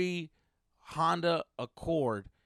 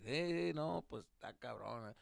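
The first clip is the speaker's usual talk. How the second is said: indifferent dad; 2 semitones lower, 9 dB quieter than usual. Retold or imitated. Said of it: "my dad didn't give a fuck".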